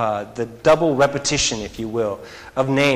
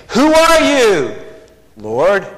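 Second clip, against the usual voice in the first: stunned cry; 6 semitones higher, 5 dB louder, slower than usual. Acted. Saul cries out, stunned.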